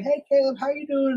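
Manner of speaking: high-pitched, sing-out greeting; imitated friendly mom. Mom greets her son's friend from another room, friendly. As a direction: call it out like a welcoming mother.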